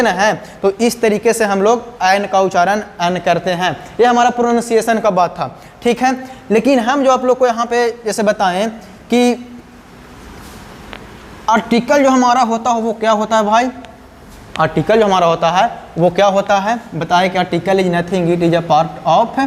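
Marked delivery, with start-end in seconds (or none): none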